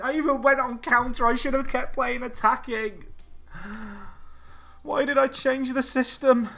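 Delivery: high, loud self-berating rant; frustrated rant. Real, not acted. Gamer ranting at himself over his tactical change. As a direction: shout at yourself for the mistake.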